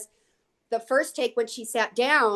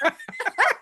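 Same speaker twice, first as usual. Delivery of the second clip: a quick high laugh at her own story; bright laugh; 12 semitones higher than usual. Real, not acted. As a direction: burst into a short high laugh.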